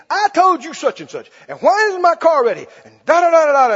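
mock an impatient customer yelling at the mechanics; angry customer. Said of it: "in foul language".

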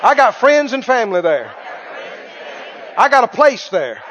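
loud, laughing preacher's testimony; joyful preaching. Acted. Preacher proclaiming heaven to the congregation, joyful and loud. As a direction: proclaim it with joy.

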